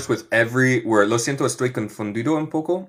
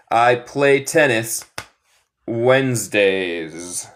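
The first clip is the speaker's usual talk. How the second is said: slow model sentence; much slower than usual. Shown as a demonstration. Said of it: "¿Qué necesitamos aquí en el blanco".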